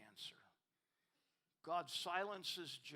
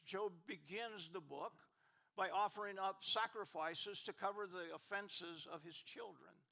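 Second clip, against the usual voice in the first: ordinary talk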